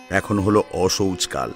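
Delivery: usual speaking voice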